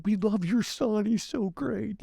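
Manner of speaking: high-pitched imitated gushing; drawn-out, sing-high mock fawning; gushing affection. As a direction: mimic adoring women fussing over someone's dad. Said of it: "hugging on my dad".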